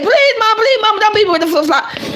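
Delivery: put-on high pleading child's voice, begging mom; a high, tearful plea from a small girl; crying little girl. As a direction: beg like a scared little girl about to be spanked. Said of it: "started crying and said".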